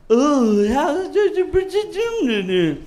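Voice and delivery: high, slow, exaggerated talking-down voice; mock someone talking down, high and drawn out; hammed-up condescending imitation; mocking condescension.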